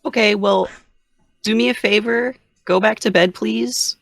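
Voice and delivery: put-on high-pitched voice for small children; coaxing parent. Parent asking children to go back to bed, high and coaxing.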